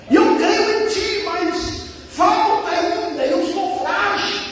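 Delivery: high, slow pleading prayer; crying out, acted. Believer admitting frailty to the Lord, acted crying out. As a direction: draw out the confession to God. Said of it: "uma vida de clamor".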